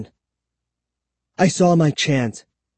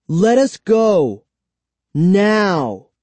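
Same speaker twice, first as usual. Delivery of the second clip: higher, slow, defiant order; firm demand; 5 semitones higher, 4 dB louder, much slower than usual. A narrator's reading. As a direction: demand release, spacing it out. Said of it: "I told him".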